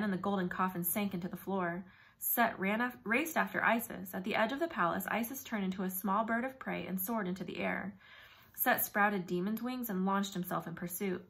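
ordinary delivery

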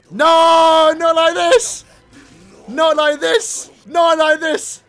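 loud, high cries of dismay; anguished protest. Real, not acted. Fan watching Rukia lose, shouting in dismay. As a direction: plead at the screen at full voice.

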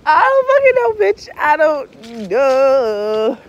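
loud high outburst, last words drawn out; exasperated bewilderment. Real, not acted. Speaker unable to explain it to viewers, loud baffled wail. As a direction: cry it out, then stretch the ending helplessly. Cannot explain the strange experience.

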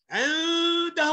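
عنده with the n held as a hum; held ikhfa. Shown as a demonstration. Teacher explaining ikhfa, held n in عنده. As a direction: linger on the ن in عنده. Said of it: "وقفت عليها".

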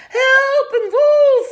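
very high, loud frightened scream; mock fright. A narrator's reading. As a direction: shriek as if startled by the wolf. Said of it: "doet of ze heel erg schrikt".

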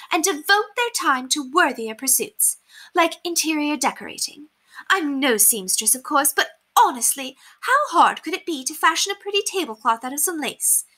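high, affected society-lady voice; haughty lady, exasperated. A narrator's reading. Lady advising the poor on decorating, haughtily exasperated. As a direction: lecture grandly in a high voice. Narrator plays Lady Constance.

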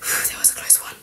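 soft whispered sigh of relief; relieved whisper. Acted. Hider after a near miss, relieved whisper. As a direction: let it out under your breath. Intruder has just left.

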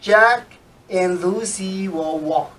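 slow, clear sentence for students to follow; slow read for repeating. Shown as a demonstration. Teacher reads for students to repeat after. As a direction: read it slowly so they can repeat. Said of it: "Please follow".

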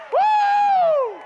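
very high, loud "woo"; exultant whoop. Real, not acted. Preacher overjoyed at things working out, exultant whoop. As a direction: let out a piercing whoop.

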